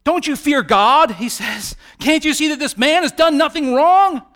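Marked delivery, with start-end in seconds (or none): 0.0-1.1 loud, high indignant outburst; raised-voice rebuke; incensed. Acted. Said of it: "he becomes incensed". 2.0-4.2 high, loud indignant protest; outraged defense at raised pitch; incensed outburst. Acted. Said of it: "almost, like, bursts out of him".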